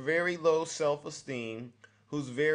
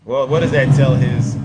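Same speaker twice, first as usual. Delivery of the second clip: loud; 16 dB louder than usual. Real, not acted. Host retorting loudly on air.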